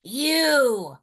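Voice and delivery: loud hungry wolf; wolf cry. A narrator's reading. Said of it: "cried the wolf".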